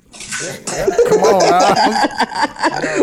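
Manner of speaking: put-on high, playful Jamaican accent. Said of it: "Why you doing the Jamaican accent?".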